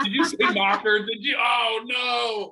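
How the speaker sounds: high, loud mock horror; mock outrage. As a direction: cry out in pretend dismay over a botched name. Mock outrage at mispronounced name.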